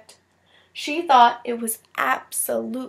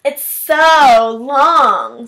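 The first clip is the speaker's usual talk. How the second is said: mocking; 12 dB louder, much slower than usual. Classmate mocking a long name.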